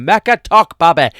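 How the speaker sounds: loud, high mock Doncaster accent; thick Doncaster accent. Mocking friend's podcast catchphrase in thick Doncaster accent. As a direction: belt it out in broad Doncaster. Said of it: "in a thick Doncaster accent".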